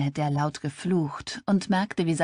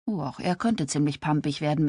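plain reading voice

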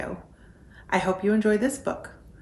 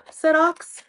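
usual speaking voice

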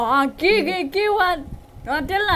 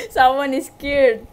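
usual speaking voice

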